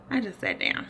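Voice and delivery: ordinary talk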